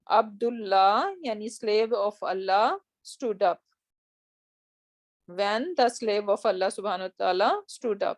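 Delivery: careful slow translation; slow and deliberate. Shown as a demonstration. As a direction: spell out each word slowly.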